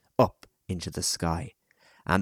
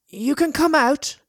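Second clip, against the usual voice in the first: put-on high, louder boy's voice; boy calling out; 6 dB louder than usual. A narrator's reading. Boy calls hidden children out, high raised voice. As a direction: coax them out, calling across.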